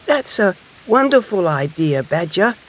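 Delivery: high, pleased little hedgehog; smiling delight. A narrator's reading. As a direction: praise a friend's idea happily. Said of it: "smiled Little Hedgehog".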